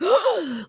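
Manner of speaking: imitated sharp, high gasp; sudden gasp. Shown as a demonstration. Woman showing how she came back to her body, sudden gasp. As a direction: gasp as if jolted back to life. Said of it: "like that".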